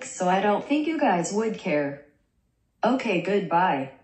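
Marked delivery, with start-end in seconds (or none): none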